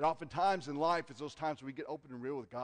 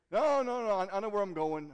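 stubborn husband; 6 semitones higher, 8 dB louder than usual. Pretend. Man refusing directions.

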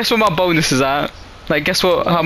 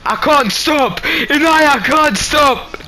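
panicked shout; 7 semitones higher, much slower than usual. Real, not acted. Car out of control.

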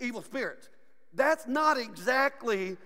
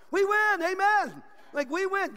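high, loud victory cry; triumphant; 7 semitones higher, 4 dB louder than usual. Real, not acted. Preacher telling the church of victory, triumphant. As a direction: celebrate it, pitched well up.

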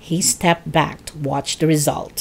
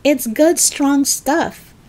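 higher, lightly voiced boy; George reassuring; 7 semitones higher, slower than usual. A narrator's reading. George defending his medicine to Grandma, reassuring. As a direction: vouch for it in a raised, easy voice.

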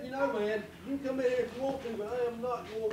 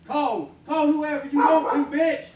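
shouting; 3 semitones higher, 11 dB louder than usual. Real, not acted. Suspect yelling defiance.

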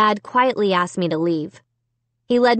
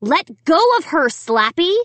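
raised-pitch urgent command; insistent, worried demand; 6 semitones higher than usual. A narrator's reading. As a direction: order it firmly, with alarm. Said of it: "I demanded".

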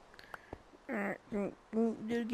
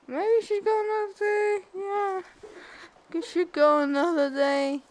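high, loud relief; 9 semitones higher, 10 dB louder than usual. Real, not acted. Hopeful after escaping mob.

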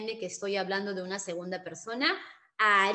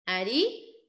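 ordinary talk

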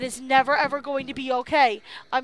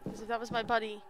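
ordinary talk

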